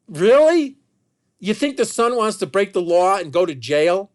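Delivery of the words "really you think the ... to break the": loud, high-pitched disbelief; incredulous. Real, not acted. Speaker defends son against theft claim, loudly incredulous. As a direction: throw it out as an indignant challenge. Scoffs at the absurd accusation.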